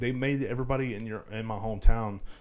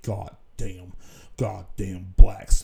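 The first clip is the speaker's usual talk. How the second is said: drawn-out slur, louder at the end; mimicking hateful boomer; 3 semitones lower, 9 dB louder, much slower than usual. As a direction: sneer it like an old bigot glued to the news. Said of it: "filled with hate".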